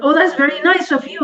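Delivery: high-pitched, polite mock gratitude; retold over-sweet thank-you. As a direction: lift the pitch for a show of courtesy. Polite reply hiding her real thought.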